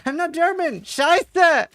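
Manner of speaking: high, loud hammed-up German shout; mock German yelling. Answering the yelling German man, mock German shout. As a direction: bellow like a comic angry German. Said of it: "Why is this German man yelling at me".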